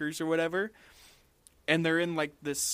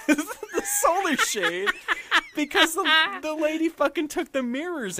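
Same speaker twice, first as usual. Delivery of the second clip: laughing; 13 semitones higher, 5 dB louder than usual. Real, not acted. Laughing while telling car mishap.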